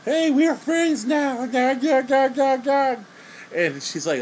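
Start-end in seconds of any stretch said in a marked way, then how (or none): 0.0-3.0 very high, drawn-out goofy caricature; simple-minded oddball. Retold or imitated. Touched man greets his new friend, hammed-up high goofy voice. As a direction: put on a high, slow, over-eager dopey voice. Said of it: "he comes out and he's all like".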